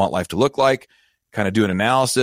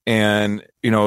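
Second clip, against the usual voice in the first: ordinary delivery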